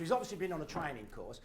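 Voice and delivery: usual speaking voice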